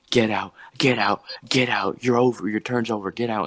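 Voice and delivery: mild mock yell of get out; half-hearted angry shouting, echoing a furious woman; retold yelling. Comic telling her rival to leave, a mild mock yell. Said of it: "she starts yelling".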